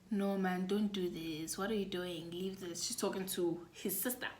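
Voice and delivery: calm the sister down under your breath; soft, urgent hush; hushed pleading. Retold or imitated. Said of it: "whispering in her brother's ear".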